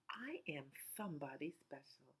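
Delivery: soft whisper to yourself. Shown as a demonstration. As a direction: whisper it like a secret affirmation. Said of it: "point it to yourself and say".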